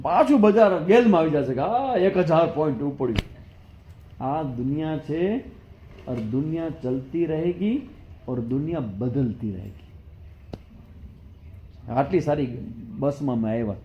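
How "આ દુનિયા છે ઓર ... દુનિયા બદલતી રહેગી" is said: reflective aside. Acted. Philosophical Hindi aside, slowed.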